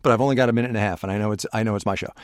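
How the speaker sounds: rushed, racing through the words; speaking really fast. Real, not acted. Host racing a minute-and-a-half limit, speaking really fast. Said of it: "I know I'm speaking really fast".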